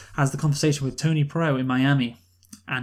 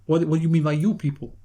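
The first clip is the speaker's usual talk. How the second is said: a hint of a tough Italian American mobster, a little louder; Tony Pro impression; 2 semitones higher, 4 dB louder than usual. Imitating Tony Pro taking offence in the meeting scene, mild mobster voice. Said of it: "this miniature Italian American who goes".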